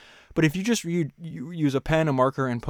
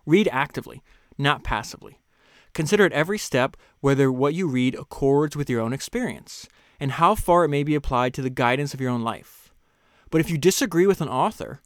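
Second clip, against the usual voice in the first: raised, measured reading voice; reading a quote aloud; 2 semitones higher, 4 dB louder than usual. Acted. Host reading a book passage to listeners, measured. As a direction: read the author's words with weight.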